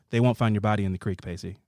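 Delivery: low, soft retold threat; understated threat. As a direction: drop it low, trailing to very quiet on the name. Retelling a threat from the show.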